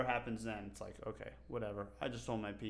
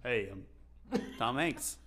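Tom Hanks; slower than usual. Acted. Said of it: "I can't do a Tom Hanks impression".

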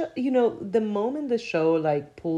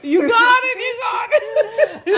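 much higher, laughing squeal; laughing shriek; 9 dB louder than usual. Real, not acted.

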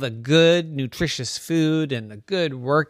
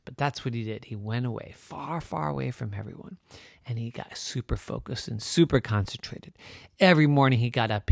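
plain reading voice

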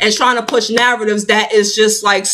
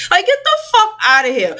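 high, heated dismissal, not a full shout; riled-up brush-off, pitched high; real anger; 8 semitones higher than usual. Real, not acted. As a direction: snap it off, fed up and worked up. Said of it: "I didn't even mean to really get that mad".

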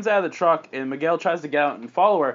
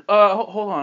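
slightly raised, hesitant stammer; flustered protest; 6 semitones higher, 5 dB louder than usual. Acted. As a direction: put the brakes on nervously. Boyfriend halts girlfriend's advances.

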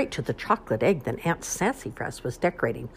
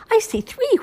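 high, loud excited whoop; 8 dB louder than usual. Acted. Bunny whooping as she finds eggs. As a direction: whoop it out like a thrilled little bunny. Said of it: "whooped Poppy".

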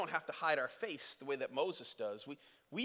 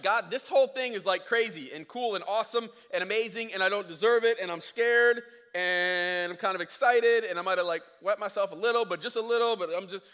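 high, loud panicky babble; freaking out; 4 semitones higher, 10 dB louder than usual. Retold or imitated. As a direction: rattle it off, frantic. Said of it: "he's freaking out".

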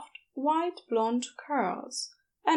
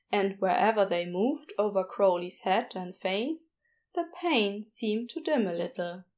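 plain reading voice

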